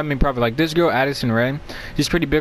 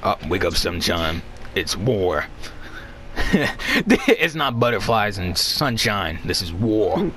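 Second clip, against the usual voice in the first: deep dramatic voice; 7 semitones lower, slower than usual. Pretend. Dramatic wake-up call voice.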